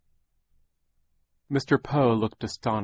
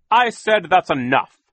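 louder, higher angry growl; Mister Poe growling; 9 semitones higher, 11 dB louder than usual. A narrator's reading. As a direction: banker losing patience. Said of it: "Mister Poe growled".

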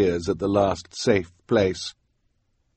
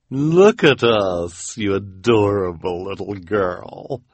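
cooing villainess; 3 dB louder, slower than usual. Acted. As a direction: coo grandly at the child. Villainess woos child.

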